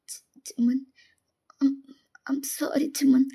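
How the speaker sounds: hesitant, whispery, close to tears; stammering, tearful near-whisper; teary apology. Acted. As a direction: falter through a slow, breath-filled apology. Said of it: "நீங்க அவனையே பாக்குறீங்க teary ஐஸோட".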